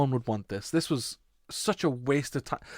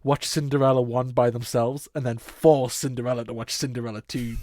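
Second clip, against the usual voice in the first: normal talking voice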